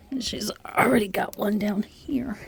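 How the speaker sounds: hushed whisper, barely voiced. Real, not acted. To viewers about the kitten close by, whispered. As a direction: whisper so the kitten isn't disturbed.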